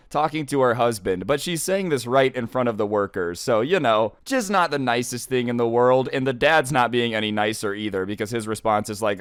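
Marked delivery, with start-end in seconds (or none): none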